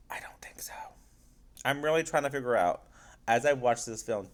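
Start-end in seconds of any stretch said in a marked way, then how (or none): 0.1-0.7 hushed, voiceless answer; whispered aside. Real, not acted. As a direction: drop to a whisper to admit doubt. Answers own question in an aside.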